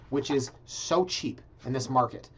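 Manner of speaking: ordinary delivery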